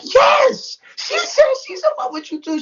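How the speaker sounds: high-pitched squealing imitation; retold gush, pitched far up; excited confirmation. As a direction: imitate an excited friend spilling the secret.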